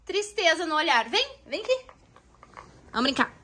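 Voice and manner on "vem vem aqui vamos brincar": high, soft coaxing call. Real, not acted. Mother coaxing her sad daughter to come play, high and soft.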